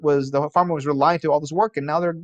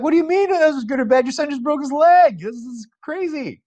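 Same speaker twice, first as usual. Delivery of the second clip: much higher, fast and loud, then trailing off; incredulous neighbor; 13 semitones higher, 3 dB louder than usual. Acted. Neighbor shouting disbelief at the farmer about his son's broken leg, high and fast. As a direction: play the neighbor who can't believe the farmer's calm.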